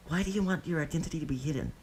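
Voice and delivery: put-on high little boy's question; curious child. A narrator's reading. Child Deidara suddenly asking Obito about his mask, high curious voice. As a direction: ask like a nosy kid. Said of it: "barrage of childish questions".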